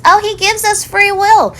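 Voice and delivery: high, slow, sing-song mimic; squeaky mock of a smug believer; parroting Christians, high and drawn out. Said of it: "Here come the Christians".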